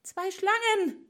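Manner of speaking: high, slow startled cry; frightened cry. A narrator's reading. Nurse waking to see two snakes, frightened cry. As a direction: gasp it out in fright. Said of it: "rief sie erschrocken".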